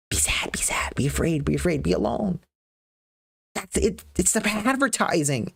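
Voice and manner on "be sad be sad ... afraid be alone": whispered inner voice. Acted. Mind's voice whispering sadness.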